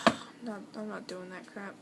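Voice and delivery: ordinary talk, nothing marked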